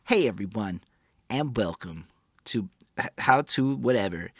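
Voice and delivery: upbeat how-to video host voice; friendly tutorial intro voice; customer service voice. Retold or imitated. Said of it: "The customer service voice".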